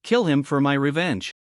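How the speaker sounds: ordinary delivery, nothing marked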